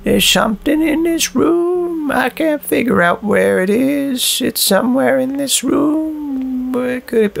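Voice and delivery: high, slow eerie sing-song; spooky voice. Pretend. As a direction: tease like a haunted ghost calling. Searching room, playful spooky voice.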